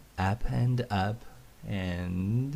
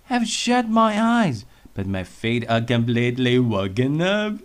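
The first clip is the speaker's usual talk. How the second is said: much higher, louder, bouncy; 4 semitones higher, 8 dB louder than usual. Acted. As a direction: bounce the words with energy. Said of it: "in a very bouncy voice".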